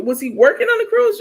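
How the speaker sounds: high, loud puzzled question; high confused question. Real, not acted. Asking chat about the cruise, puzzled. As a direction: sound baffled by the story.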